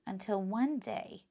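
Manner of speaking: ordinary delivery, nothing marked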